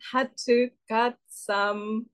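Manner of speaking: put-on laboured Welsh accent sounding vaguely Indian; bad Welsh accent. Contestant struggling through the monologue, slow bad Welsh accent. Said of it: "cannot not sound Indian doing this".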